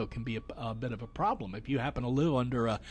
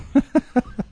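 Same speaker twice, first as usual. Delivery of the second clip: high, loud real laugh; loud burst of laughter; 11 semitones higher, 10 dB louder than usual. Real, not acted. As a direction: break into a big laugh.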